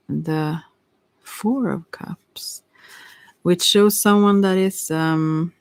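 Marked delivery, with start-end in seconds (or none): 1.2-2.6 slow ASMR-style whisper, almost no voice. Real, not acted. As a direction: breathe the card name out slowly, like an ASMR video. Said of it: "this is not A S M R".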